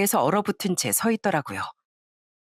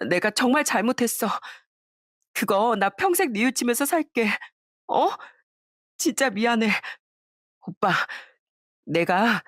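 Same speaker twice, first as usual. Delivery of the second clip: tearful apology; 4 semitones higher than usual. Acted. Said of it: "엉엉 울며 사과를".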